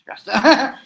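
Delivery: a sudden high, loud burst of exasperation; stressed out. Real, not acted. Recapping wrestling news, suddenly blurting out frustration, high and loud.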